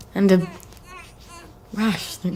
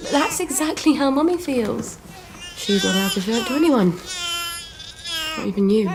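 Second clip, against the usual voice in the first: tearful; 6 semitones higher than usual. Acted. Mother tells baby her sorrow.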